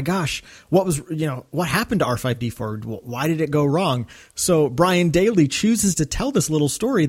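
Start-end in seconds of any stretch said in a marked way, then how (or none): none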